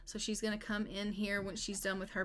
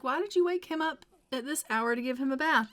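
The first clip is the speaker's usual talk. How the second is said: exasperated husband; 5 semitones higher, 6 dB louder than usual. Retold or imitated. Husband voiced in Sims story.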